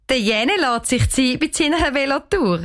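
a hint of a giggle to oneself; giggling. A narrator's reading. Unworried aunt talking to herself, giggling. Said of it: "kichert sie vor sich hin".

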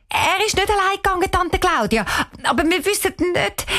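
put-on high, hurried girl's answer; quick blurt. A narrator's reading. Cheyenne correcting her aunt, a quick high blurt. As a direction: blurt it out fast, pitched high. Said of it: "Cheyenne sagt schnell".

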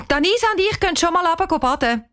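put-on hurried girl. A narrator's reading.

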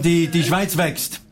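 ordinary talk

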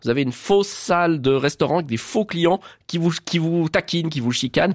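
ordinary talk

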